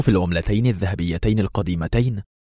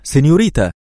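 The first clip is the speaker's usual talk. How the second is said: higher, louder second call of سينيوريتا; repeated call; 6 semitones higher, 6 dB louder than usual. A narrator's reading. Shopkeeper still chasing the woman, calling louder. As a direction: call her back with rising urgency. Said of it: "وعدا خلفها هاتفا".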